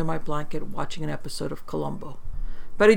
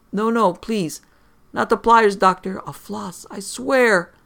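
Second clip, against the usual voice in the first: loud high begging of the dentist; pleading terror; 5 semitones higher, 7 dB louder, slower than usual. Acted. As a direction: beg the dentist in panic. Father begs imaginary dentist.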